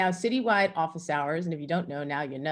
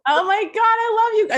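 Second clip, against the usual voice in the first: much higher and louder, gushing with delight; overjoyed outburst; 9 dB louder than usual. Real, not acted. Colleague thanked in her farewell tribute, real excited cry. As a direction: burst out with stunned joy.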